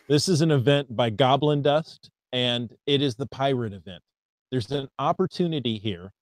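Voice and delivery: ordinary delivery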